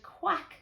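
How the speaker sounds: short, high quack; duck's quack. A narrator's reading. Duck after the thieves are caught, high quack. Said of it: "said the duck".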